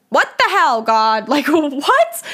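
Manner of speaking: high, loud disbelieving cry; exasperated outburst. Retold or imitated. Retelling how she yelled at God, loud incredulous outburst. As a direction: throw the question at the sky, incredulous. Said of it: "And I was like".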